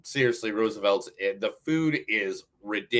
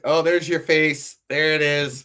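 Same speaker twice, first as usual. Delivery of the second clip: loud, high, gleeful teasing; 7 semitones higher, 10 dB louder than usual. Real, not acted. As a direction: crow over the photo like you caught him. Teasing friend over old photo.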